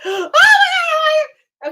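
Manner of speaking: very high, drawn-out mock scream; crazy screaming. Woman re-enacting fleeing a bee in her car, screaming. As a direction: shriek it in hammed-up panic, long and high. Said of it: "screaming like a crazy person".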